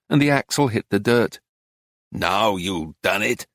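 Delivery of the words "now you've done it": slow, lower, leering taunt. A narrator's reading. Driver leers at narrator over the broken jack, slow and leering. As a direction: draw it out with a low leer. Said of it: "he leered".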